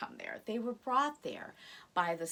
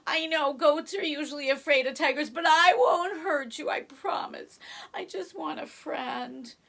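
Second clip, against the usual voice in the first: reassuring tiger; 6 semitones higher, 8 dB louder than usual. Acted. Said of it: "And Tigre says".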